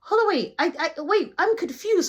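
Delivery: a hint of a high, flustered guy; lightly voiced befuddled friend; confused coworker. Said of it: "So he was confused".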